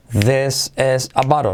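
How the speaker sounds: slow, careful "this is a bottle", bottle as "baro"; slow joined-up model. Shown as a demonstration. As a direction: model "this is a bottle" slowly for repeating. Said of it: "Por lo tanto, todo junto". ("this is a bottle" lento, para repetir)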